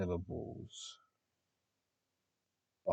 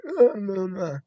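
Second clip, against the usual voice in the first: mock strained squeak, much higher and louder; croaky unused voice; 13 semitones higher, 15 dB louder, slower than usual. Imitating a man whose voice is rusty from days of silence, high strained croak. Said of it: "the dude literally just sounds like".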